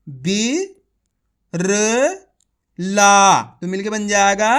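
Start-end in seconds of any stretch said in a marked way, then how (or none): 0.1-3.4 each syllable spaced out, last one loud. Shown as a demonstration. Teacher to pupils, sounding out syllables separately. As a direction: sound out बी, र, ला one at a time, slow and clear. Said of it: "एक बार फिर से देखिए".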